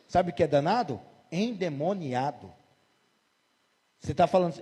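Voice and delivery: usual speaking voice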